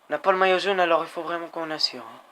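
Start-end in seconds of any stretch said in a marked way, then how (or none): none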